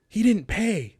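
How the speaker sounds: high, loud, shocked; alarmed staff. Retold or imitated. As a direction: blurt it out as if caught off guard. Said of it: "And they're like".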